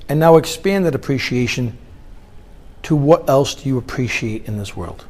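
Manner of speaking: quiet guide speaking over background music; guided meditation. Acted. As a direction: ease the room into stillness. Leads a meditation.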